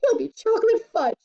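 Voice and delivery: high, alarmed wail; high-pitched panicking mother; frantic mother. A narrator's reading. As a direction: protest it up high. Mother protests to calm Wonka.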